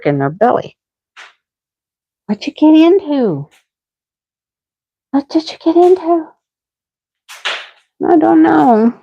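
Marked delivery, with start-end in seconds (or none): 2.3-3.5 higher sing-song pet voice. Real, not acted. Owner asking her sick dog in high baby talk. 5.1-8.9 higher cooing pet voice. Real, not acted. Owner coaxing her sick dog in high baby talk.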